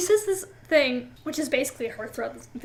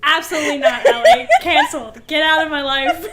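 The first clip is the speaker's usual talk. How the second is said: laughing loud protest; 2 semitones higher, 9 dB louder than usual. Real, not acted. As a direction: shriek it at your friend in fake horror. Reacting to co-host's joke.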